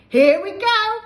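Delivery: loud, high eager call; excited cry. Acted. George giving grandma medicine, loud excited cry. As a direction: cry out with glee. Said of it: "George cried out".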